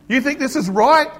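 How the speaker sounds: loud, high-pitched scolding; confronting peacemaker. Acted. Peacemaker telling off two people arguing, loud confrontation. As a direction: raise your voice and take them to task. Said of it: "A peacemaker grabs them, smacks them".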